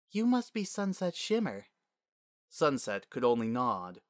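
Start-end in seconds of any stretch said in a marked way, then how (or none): 0.1-1.7 high, gracious princess voice; smiling princess. Acted. Princess meets a nervous filly, smiling. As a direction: greet the child with a smile. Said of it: "The princess smiled and said".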